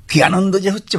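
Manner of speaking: আনন্দে গলা চড়ে যাওয়া, উঁচু সুরে; খুশিতে উঁচু গলায় বলো; excited joy. Real, not acted. Recalls joy of first recording. (উচ্ছ্বসিত আনন্দে, উঁচু স্বরে)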